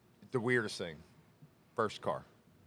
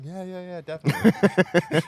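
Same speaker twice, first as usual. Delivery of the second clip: eager coworker reply; 10 semitones higher, 14 dB louder than usual. Retold or imitated.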